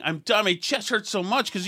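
usual speaking voice

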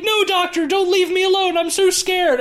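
high-pitched hammed-up scared nurse; mock panic. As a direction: imitate a frightened movie damsel, voice way up. Voices the nurse begging the doctor.

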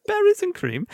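high-pitched, imitated British accent. Ad writer instantly reading the new flavor name, in British accent. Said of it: "immediately read it in a British accent".

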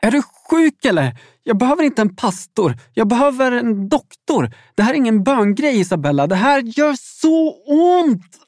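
high, frantic shouting; furious, pain-racked shout building to a peak; screamed in pain. A narrator's reading. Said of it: "skrek jag".

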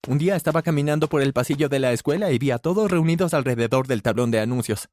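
usual speaking voice

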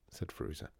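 usual speaking voice

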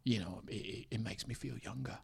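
very quiet British impression; Jason Statham. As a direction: confide it softly into the mic. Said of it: "whisper it a little bit".

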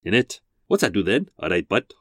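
warm-up lines in a mock Welsh voice; understated comic Welsh accent. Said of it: "in a rather offensive Welsh accent".

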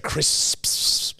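"crisps" with a long hissing S at the end; drawn-out final S. Retold or imitated. Mocking co-host's way of saying crisps, hissed ending. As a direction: overdo the last S of "crisps". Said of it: "too much emphasis on the end S".

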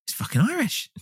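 a cartoon Irish voice for a laugh; mock Irish accent. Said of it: "I don't remember thinking".

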